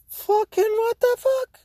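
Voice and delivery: very high, slow, drawn-out disbelief; high-pitched exasperation. Real, not acted. Man recounting dog shoving his son off couch, exasperated. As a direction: squeal it slowly in disbelief.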